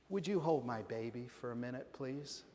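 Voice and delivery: soft, low, polite plea; quietly voiced parent asking a favor; gentle request. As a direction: drop to a hushed, mild ask. Parent gently asks scowler to hold baby.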